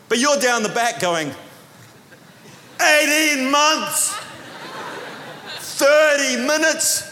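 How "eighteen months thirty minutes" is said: sarcastic complaint. Retold or imitated. Grumbling back-row believer.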